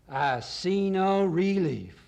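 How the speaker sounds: loud, high, drawn-out presidential bellow; Colonel Corn Pone. Acted. Colonel searching a spinning globe, loud drawl. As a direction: stretch it slowly, loud and high.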